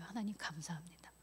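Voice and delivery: very soft, low, whispery prayer; retold prayer of thanks, barely voiced; hushed thanks. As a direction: breathe out quiet gratitude to God. Retells tearful thanks to God.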